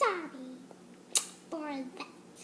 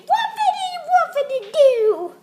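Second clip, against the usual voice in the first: higher, louder whoopity call; loud silly whoop; 6 semitones higher, 8 dB louder than usual. Acted. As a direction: belt it out goofily. Owner speaks dog.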